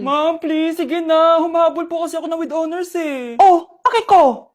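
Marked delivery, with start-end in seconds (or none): 3.4-4.5 slow, loud scoff; dismissive sneer. Acted. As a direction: brush the student off coldly. Strict teacher refuses quiz. (mapagmataas na pagtataray)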